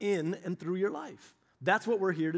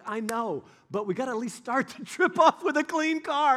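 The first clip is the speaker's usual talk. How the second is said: high, loud pleading with a laugh in it; playful pleading; 7 semitones higher, 4 dB louder than usual. Retold or imitated. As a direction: beg them to start the trip clean, laughing. Pleading with kids to wash vans.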